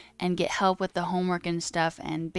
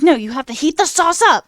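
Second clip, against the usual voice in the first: loud, high-pitched mock scolding; scolding roommates; 7 semitones higher, 13 dB louder than usual. Roommates scolding the streamer over cold sauce, mock-outraged. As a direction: imitate horrified roommates yelling a correction.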